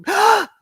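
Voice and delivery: a real, high, loud sudden gasp of shock; sharp gasp. Real, not acted. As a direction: gasp in alarm at the bad news. Shocked reading a viewer's news.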